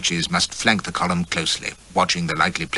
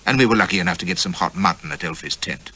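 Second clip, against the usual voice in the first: usual speaking voice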